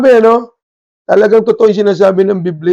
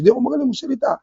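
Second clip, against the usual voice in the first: higher, softer mock of a shy stammerer; timid Moses; 7 semitones higher, 7 dB quieter, much faster than usual. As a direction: squeak it out like a man afraid to speak. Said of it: "anong sabi ni Moses".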